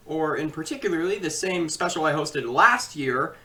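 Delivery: ordinary delivery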